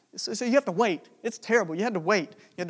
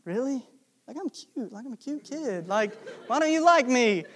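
put-on higher, pleading kid voice; hurt kid; 6 semitones higher, 4 dB louder than usual. Boy asking why the girl won't like him, higher and pleading. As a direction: play your younger self, stung and disbelieving. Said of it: "I was like".

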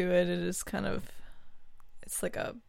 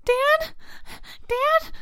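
high, sobbing call; tearful child; 3 dB louder than usual. Acted. Child calling Dad over dead guinea pig, sobbing. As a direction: cry out for a parent. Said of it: "tears aren't gonna make a difference".

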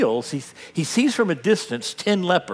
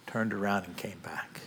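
hushed; 7 semitones lower, 12 dB quieter than usual. Acted. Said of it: "hushed storytelling".

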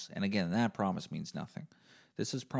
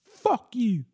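much higher and louder put-on voice; mock yelled insult; 10 dB louder than usual. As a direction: spit it out in a squeaky mock yell.

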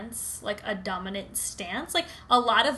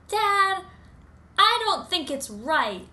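put-on higher, timid little boy; 9 semitones higher, 6 dB louder, slower than usual. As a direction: play a nervous small kid. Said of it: "And he was like".

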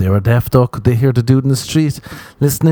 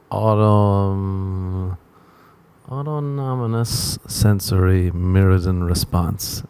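careful slow reading; 2 semitones lower, 4 dB quieter, much slower than usual. Shown as a demonstration. Reading the term out slowly.

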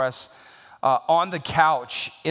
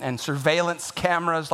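ordinary delivery

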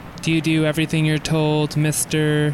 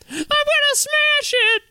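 squealed threat, pitched way up; high threatening wife; slower than usual. Acted. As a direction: shriek it as a threat.

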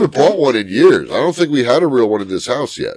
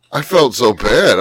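normal talking voice

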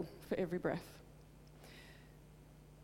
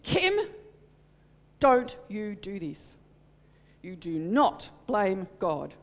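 loud, slow stern rebuke; a mother laying down the law, slow and loud; firm righteous scolding, loud and slow; righteous anger; 6 dB louder, slower than usual. Retold or imitated. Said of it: "it was a righteous anger".

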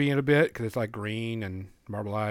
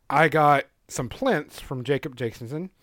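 ordinary delivery

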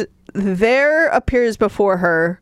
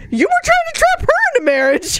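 incredulous mock; faster than usual. Mocking the hero's hypocrisy.

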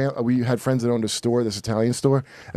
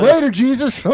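high, loud mock goodbye; loud, cheeky send-off; flippant farewell; 10 semitones higher, 5 dB louder than usual. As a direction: toss it off as a big, high kiss-off. Acted farewell to religion.

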